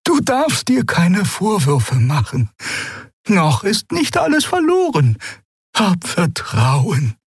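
put-on high, slow, soothing old woman's voice; aged healer reassuring her companion; gentle old Xea. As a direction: comfort him gently, slowly. Xea comforts Keru.

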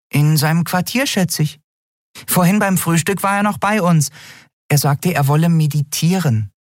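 very high, sweet and mock-innocent; high fairy voice feigning innocence; innocent tiny sprite. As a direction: play it butter-wouldn't-melt. Nelen answers lightly.

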